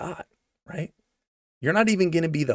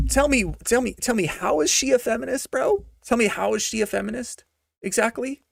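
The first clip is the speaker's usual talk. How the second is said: incredulous; 8 semitones higher than usual. Real, not acted. Incredulous rant at listener.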